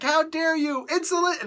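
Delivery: high, loud, scandalised cry; hammed-up alien outrage; mock outrage. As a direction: shriek at the insult. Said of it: "They're literally screaming".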